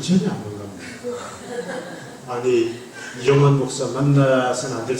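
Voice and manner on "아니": normal talking voice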